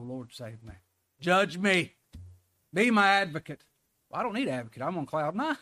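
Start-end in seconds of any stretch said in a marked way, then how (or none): 0.0-3.6 desperate cry to the Lord. Acted. Preacher acting out a soul crying to God, loud plea.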